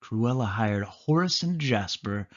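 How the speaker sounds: usual speaking voice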